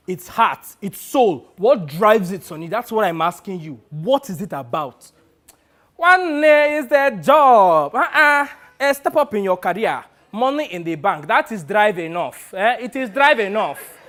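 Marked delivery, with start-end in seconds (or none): 0.1-4.4 louder, punched phrases pressing for an answer; insistent plea. Acted. Presses agent for the meaning. 6.0-14.0 loud, high exclamation easing into firm insistence; Sonny, exasperated. Acted. Plays agent Sonny retorting loudly.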